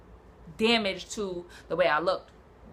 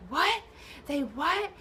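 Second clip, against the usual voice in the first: shocked question; 8 semitones higher, 4 dB quieter, slower than usual. Retold or imitated. Ex shocked hearing the news.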